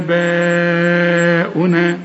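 آباؤنا stretched four beats, chanted; slow chanted madd; long madd. Shown as a demonstration. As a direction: hold the long vowel in آباؤنا. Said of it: "مد أربع حركات".

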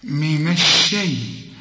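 من الشيء slow with a light thin shin. Shown as a demonstration. Teacher showing the correct shin in الشيء. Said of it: "إزاي بقى".

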